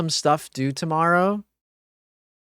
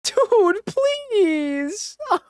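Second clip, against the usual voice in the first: very high, stretched-out please; high desperate plea; 14 semitones higher, much slower than usual. Real, not acted. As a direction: wail it. Begging game.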